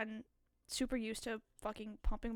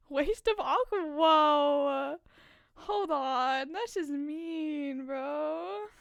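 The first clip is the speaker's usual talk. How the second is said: outraged shout; 2 semitones higher, 9 dB louder, much slower than usual. Real, not acted. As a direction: shout back, hurt and offended. Mock outrage at chat.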